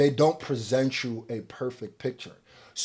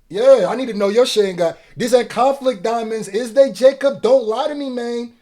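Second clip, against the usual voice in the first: higher, louder, pressing the question hard; heated call-out; 8 semitones higher, 5 dB louder than usual. Real, not acted. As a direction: grill him like you caught him lying.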